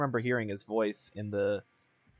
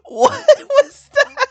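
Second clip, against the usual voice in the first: high wheezing laughter; laughing hard; 15 dB louder, much slower than usual. Real, not acted. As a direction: ask while cracking up.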